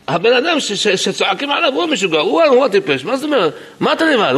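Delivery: high-pitched, heated disbelief; indignant protest. Retold or imitated. Defending the man who shouted a warning, indignant and high.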